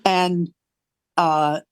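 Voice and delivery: ordinary talk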